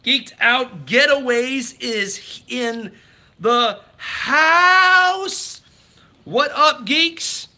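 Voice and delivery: high, loud, slow announcer call; booming welcome impression; hype announcer impression. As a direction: hype the crowd like an arena host. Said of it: "I do a little impression tonight".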